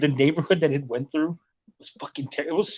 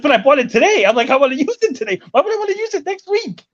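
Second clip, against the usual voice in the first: indignant; 10 semitones higher, 3 dB louder than usual. Retold or imitated. Arguing with bus driver.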